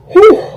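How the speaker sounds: high, loud exhaled "whew". Real, not acted. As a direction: let out a big, high whew. Exhales mid-story before resuming.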